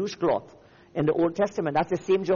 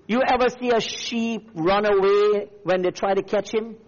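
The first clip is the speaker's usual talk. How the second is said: raised, forceful preacher's delivery; fiery preaching; 5 semitones higher, 5 dB louder than usual. Real, not acted. Preacher challenging the church about a gentle Savior, fiery. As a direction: preach it hard, driving each phrase.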